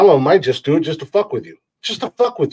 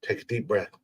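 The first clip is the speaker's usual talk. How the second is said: mock soothing; 4 semitones lower, 11 dB quieter than usual. Shown as a demonstration. Calming the listeners down.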